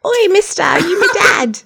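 high, loud English urchin call; hammed-up, squawking English accent; mock English accent. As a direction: holler it in a silly English voice. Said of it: "English accent".

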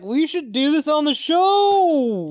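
high, loud, drawn-out enthusiasm; excited exclamation. Retold or imitated. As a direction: belt out the idea, stretching the end. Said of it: "And I was like".

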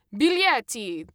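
loud, drawn-out Italian shout; shouted demand. A narrator's reading. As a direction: shout it like a ticket checker demanding fares. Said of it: "the man shouted".

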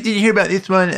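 Capote hosting The Tonight Show; high, quick Capote impression; Truman Capote voice. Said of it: "now it sounds more like Truman Capote".

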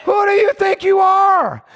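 defiant shout. Acted. David shouting down Goliath.

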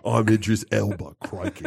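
mock Aussie Idris Elba; Australian accent. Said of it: "like a drunk Aussie".

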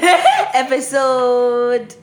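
laughing through the word, very slow. Real, not acted. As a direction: giggle out the intro.

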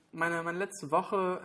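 ordinary talk, nothing marked

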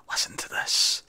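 whispering. Retold or imitated. Wife whispers about silent drapes.

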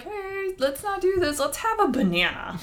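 a lightly voiced sensible grown-up; imitated caring adult. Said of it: "So nobody was like".

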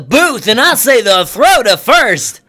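high, slow drawled country announcer; a pretend ballpark announcer boasting in a mock southern accent; hammed-up southern announcer. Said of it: "stop with this fucking accent".

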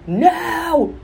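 hammed-up child scream; mock scream. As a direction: shriek it like a toddler. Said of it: "you yell and scream at them".

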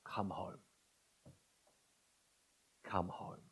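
soft, hushed pleading call; a father softly calling his children home; father's quiet call. Acted. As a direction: call them home gently, almost a whisper. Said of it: "heed our Father's call".

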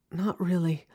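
ordinary talk, nothing marked

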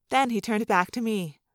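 plain reading voice

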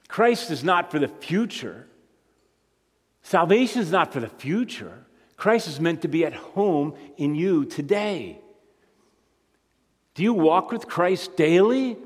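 usual speaking voice

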